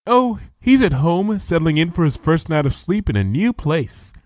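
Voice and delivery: a timid pony working up her courage; timid Fluttershy. Acted. Fluttershy talks about her rat.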